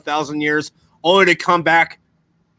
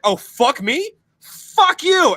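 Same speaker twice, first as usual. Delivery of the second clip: hammed-up indignant comeback; mock outraged God; 12 semitones higher, much slower than usual. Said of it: "And then God's like".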